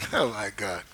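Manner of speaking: normal talking voice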